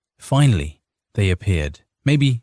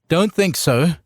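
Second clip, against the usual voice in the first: arguing; 9 semitones higher than usual. A narrator's reading. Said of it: "Ricard argued".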